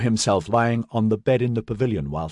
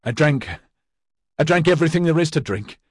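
manic; 6 semitones higher, faster than usual. A narrator's reading. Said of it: "manic he must be appearing".

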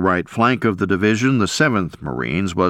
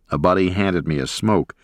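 plain reading voice

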